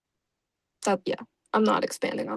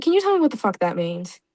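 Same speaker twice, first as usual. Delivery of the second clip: normal talking voice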